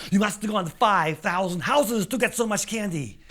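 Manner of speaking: acted disbelief; amazed. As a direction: marvel out loud at the huge haul. Lance amazed at teenager's candy.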